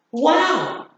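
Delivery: high, drawn-out wow of amazement; surprised exclamation. Shown as a demonstration. Teacher showing students an exclamation mark, surprised. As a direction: exclaim it in surprise, pitch arching up. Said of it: "sentences expressing surprise".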